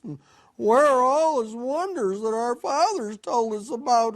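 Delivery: high, put-on protesting voice; doubting complaint. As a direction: play a sceptic's aggrieved questioning. Doubting Gideon questions the angel.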